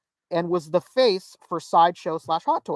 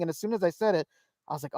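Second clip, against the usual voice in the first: usual speaking voice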